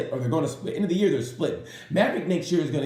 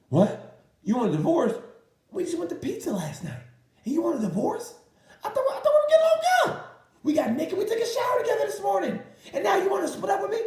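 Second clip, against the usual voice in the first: shrieking high falsetto disbelief, laughing; hammed-up shocked husband. Husband comes home to find wife leaving, squealing high in hammed-up disbelief. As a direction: whine it like a jilted husband, getting higher and higher. Husband hit by divorce news.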